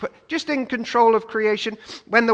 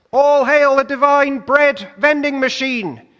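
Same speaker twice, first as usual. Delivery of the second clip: higher, louder hammed-up cheer; mock crowd acclaim; 5 semitones higher, 7 dB louder than usual. Preacher voicing the crowd, mock grand acclaim. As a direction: hail him like a grand king, tongue in cheek. Crowd mockingly hailing Jesus.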